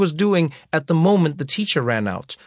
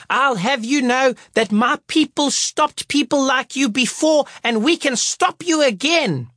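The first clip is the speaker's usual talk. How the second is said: livid teacher; 10 semitones higher than usual. Retold or imitated. Teacher scolding the performers, mock outraged. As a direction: play a furious teacher, dragging words out. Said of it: "teacher's threat".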